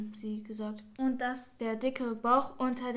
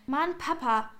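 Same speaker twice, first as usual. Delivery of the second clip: higher, louder exclamation from the hall; calling out; 4 semitones higher, 5 dB louder than usual. A narrator's reading. Jan calling to Papa in the hallway, raised exclamation. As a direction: call out to Papa in exasperation. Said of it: "hörte Lea in dem Flur rufen".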